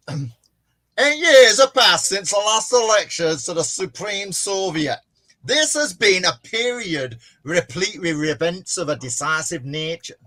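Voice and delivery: loud, high, hammed-up northern English speech; oratory in an uncertain northern English accent. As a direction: declaim it in exaggerated northern English. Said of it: "I'll do the northern one".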